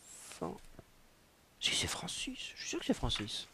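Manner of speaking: unvoiced whisper to self; half-whispered aside. Real, not acted. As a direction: mutter it privately. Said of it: "whispered self-correction".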